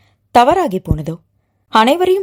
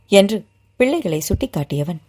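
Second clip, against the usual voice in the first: usual speaking voice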